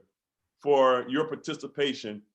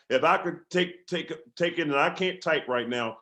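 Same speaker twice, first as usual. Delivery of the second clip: usual speaking voice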